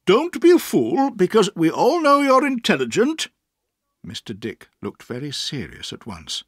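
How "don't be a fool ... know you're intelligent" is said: put-on high, firm old aunt. A narrator's reading. Aunt addresses Mister Dick firmly. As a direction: settle him down with authority. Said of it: "said my aunt firmly".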